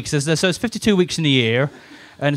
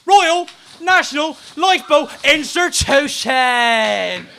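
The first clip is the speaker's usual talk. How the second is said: slow emphatic; 9 semitones higher, much slower than usual. Acted.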